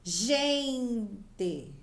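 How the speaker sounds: "Gente" with GEN held long, TE short; stressed syllable demo. Shown as a demonstration. Teacher shows children the strong syllable, stretching GEN in "Gente". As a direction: draw out the first syllable of "Gente". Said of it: "Qual que eu falei mais forte?".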